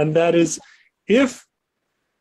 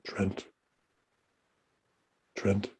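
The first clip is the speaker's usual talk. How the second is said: ghostly whispered call, much softer; eerie whisper; 6 semitones lower, 14 dB quieter than usual. Acted. The unseen voice calling the keeper in the dark, whispered. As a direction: breathe his name like a phantom in the fog. Spooky voice calls in lighthouse.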